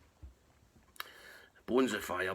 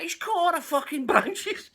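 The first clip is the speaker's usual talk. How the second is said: exasperated disbelief; 13 semitones higher, 6 dB louder than usual. Retold or imitated. Exasperated retelling, high and loud.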